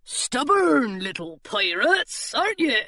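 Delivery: guard dismissing the crew; burly pirate. A narrator's reading. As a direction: send them off. Pirate eyes children.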